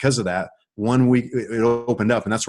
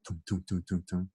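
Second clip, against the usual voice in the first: soft, muted thumps under the breath; imitated stadium light thumps; 4 semitones lower, 13 dB quieter than usual. Man imitating stadium lights for the listener, soft thumps. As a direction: mimic the lights clunking on, much softer. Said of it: "You ever hear that sound?".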